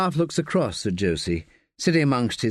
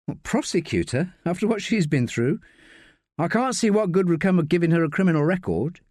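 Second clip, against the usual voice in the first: lightly voiced higher woman, questioning; Josie, disapproving; 7 semitones higher than usual. Josie objecting to prosecuting Sally, mildly incredulous.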